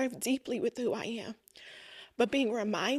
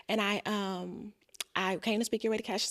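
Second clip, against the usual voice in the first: ordinary talk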